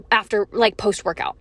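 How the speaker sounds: ordinary talk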